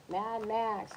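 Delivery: ordinary delivery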